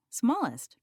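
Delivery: plain reading voice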